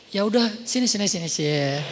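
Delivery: a touch of tender reassurance; gentle comforting. Acted. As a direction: coax her close to lean on your shoulder. Prophet comforts Aisha offering shoulder.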